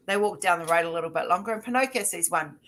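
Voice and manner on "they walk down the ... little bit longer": usual speaking voice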